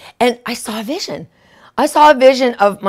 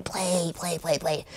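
soft, lower repeated pleading; muttering; 6 semitones lower, 14 dB quieter, slower than usual. Retold or imitated. Pressing the remote at the stuck DVD, quietly pleading. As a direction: coax the machine under your breath.

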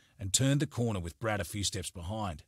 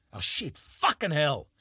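higher, slow, bitter curse; spat in frustration; 6 semitones higher, 4 dB louder, slower than usual. Acted. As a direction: spit the words out in frustration. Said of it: "Jared spat in frustration".

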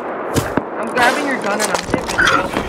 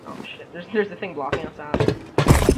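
normal talking voice

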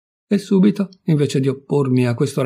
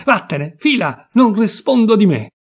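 building from a sharp command to a full, higher, louder yell; furious shout; 7 semitones higher, 4 dB louder than usual. A narrator's reading. Husband drives his wife out, building to a furious yell. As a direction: hurl the words at her like the objects he throws. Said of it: "continuando a gridare".

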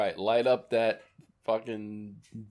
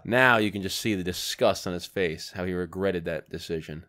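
mock commentary; 4 semitones lower, 3 dB quieter than usual. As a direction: narrate it like a nature documentary. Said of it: "Now, commentary".

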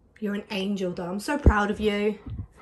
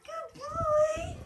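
very high soft squeak; squeaky praising a dog; 5 dB quieter than usual. Real, not acted. Praising the dog, second take.

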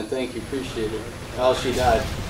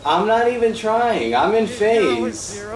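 boasting; 6 semitones higher, 3 dB louder, slower than usual. Real, not acted. Boasting after a win.

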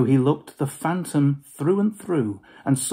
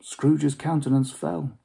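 plain reading voice

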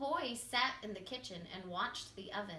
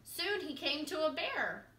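ordinary delivery, nothing marked

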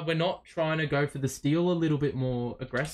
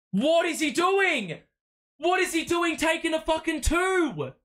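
furious shout; 11 semitones higher than usual. Real, not acted. Fan yelling at his player's bad shot.

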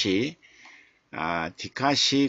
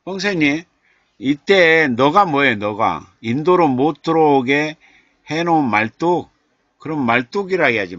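exasperated exclaiming; 4 semitones higher, 8 dB louder than usual. Real, not acted. Baffled by viewer's poem title.

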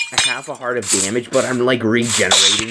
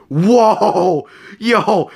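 startled; 8 semitones higher, 4 dB louder than usual. Real, not acted. Startled by enemy shot.

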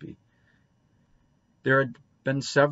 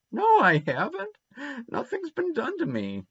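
indignant protest. A narrator's reading. Boy insisting to Anne he wasn't punished, protesting. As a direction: protest in a squeaky-high voice.

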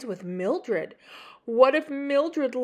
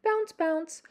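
ordinary delivery, nothing marked

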